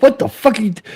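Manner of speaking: high shocked yelp at the driver; startled shout while being smacked; shocked yell. Retold or imitated. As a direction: yelp it out in sudden disbelief. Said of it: "I'm like".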